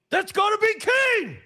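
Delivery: high-pitched, shouted wrestling commentator call; stunned play-by-play shout; commentator's call. Retold or imitated. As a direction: belt it out like a ringside announcer losing it. Quoting the commentator's famous call.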